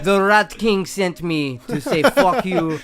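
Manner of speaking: a hint of a Russian accent. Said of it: "the Russian voice".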